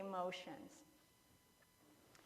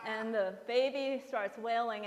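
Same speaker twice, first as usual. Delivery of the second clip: normal talking voice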